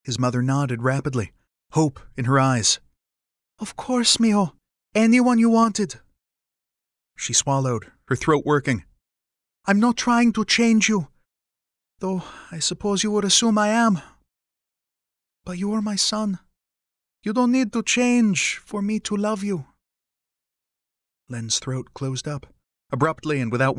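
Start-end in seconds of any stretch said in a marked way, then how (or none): 3.6-6.0 high-pitched mother agreeing readily; eager, hopeful mother. Acted. Mother with hope in her eyes, eager. 9.6-19.7 high-pitched mother reassuring her son; loving mother, emotional. Acted. Mother accepting her son as he is, heartfelt.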